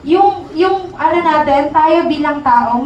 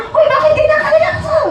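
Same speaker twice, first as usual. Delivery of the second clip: teasing question; 13 semitones higher, faster than usual. Retold or imitated. Mock teasing question from onlookers.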